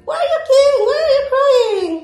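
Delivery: put-on retelling a high, loud call to a crying child; high, loud acted-out questioning; retold worried call. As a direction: re-enact calling out to the child, stretching the question. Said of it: "Sabi ko".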